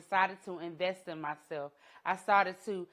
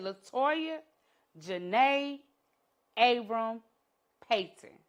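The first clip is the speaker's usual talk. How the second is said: stern mama full name; 3 semitones higher, 4 dB louder, slower than usual. Retold or imitated. Mother calling her crying daughter by full name, sternly. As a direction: call her to attention one name at a time. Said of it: "call you by your whole government name".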